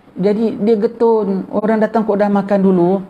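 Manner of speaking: half-act the kiai's regret; a hint of the dismayed kiai. (sedikit suara kiai yang kecewa)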